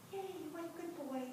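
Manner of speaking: soft, high, quick praise for a horse; gentle high cooing praise; soothing praise. Retold or imitated. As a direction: coo it to calm him.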